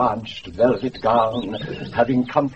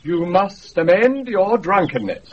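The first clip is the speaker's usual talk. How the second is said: pompous rebuke; 6 semitones higher, 7 dB louder than usual. Acted. Imagined lordly rebuke.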